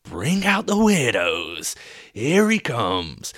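loud, high, drawn-out ringmaster call; hollered, stretched-out announcement; mock show announcer. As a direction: boom it out like a freak-show barker. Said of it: "it was like".

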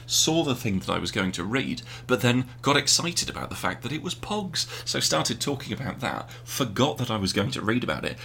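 usual speaking voice

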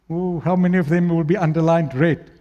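ordinary delivery

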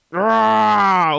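loud, high roaring bellow; bear roar. Acted.

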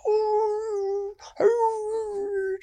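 long, high pained howl; wolf howl. Acted. Wolf with a mouthful of thorns, pained howling.

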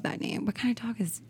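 soft excited whisper. Real, not acted. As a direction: hush it like a secret. Sudden hushed aside about dog.

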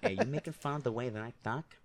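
put-on New York mobster accent with a lisp; tough-guy Italian-American accent, light lisp; Joe Pesci. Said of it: "Like, uh, Joe Pesci".

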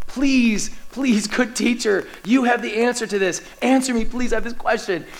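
imploring, desperate appeal; desperate pleading. Acted. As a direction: plead insistently for an answer. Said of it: "this desperation as he's pleading".

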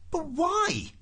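very high, exasperated question; bewildered protest. A narrator's reading. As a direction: wail it in disbelief.

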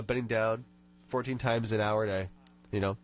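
normal talking voice

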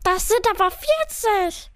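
high growled retort; growling. A narrator's reading. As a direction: snarl it out crossly. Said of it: "blieb stehen und knurrte".

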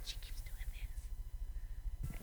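teasing whisper from the back row. Retold or imitated. Son whispering a joke about her habit, soft whisper. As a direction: hush it like a heckler muttering aside. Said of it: "Timothy's back there going".

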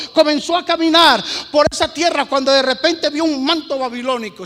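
loud, driving sermon delivery; preaching at full force. Acted. Preacher pounding the Jericho story to the church, forceful preaching. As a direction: push the story hard to the congregation.

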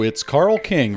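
ordinary talk, nothing marked

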